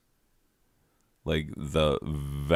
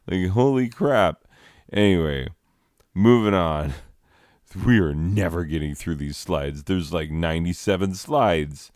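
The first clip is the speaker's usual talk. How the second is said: ordinary talk, nothing marked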